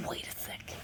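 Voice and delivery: whispered, breathless with awe; awed whisper. Real, not acted. Vlogger stunned by a gift, whispering. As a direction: hush it in disbelief.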